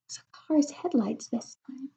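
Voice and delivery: hushed aside; whisper. Acted. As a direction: whisper it to the child.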